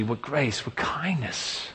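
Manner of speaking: whispered, slowing on kindness; hushed awe. Real, not acted. Preacher to congregation, marvelling at God's grace, whispered. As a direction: breathe it out in wonder.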